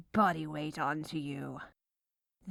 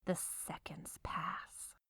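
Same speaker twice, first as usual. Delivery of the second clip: much softer whisper; 13 dB quieter than usual. Acted. Baker waiting overnight, soft whisper. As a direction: whisper it as time drags. Melodramatic baking narration.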